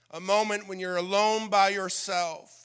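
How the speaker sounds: usual speaking voice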